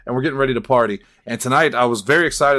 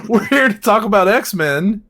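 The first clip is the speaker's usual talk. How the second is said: hyped host reprise; 8 semitones higher, 4 dB louder than usual. Acted. Host reprises bit, higher and louder.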